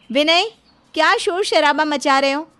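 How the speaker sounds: loud, high reprimand; sharp scolding. Acted. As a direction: scold him to stop the racket. Wife scolds husband's noisy celebration.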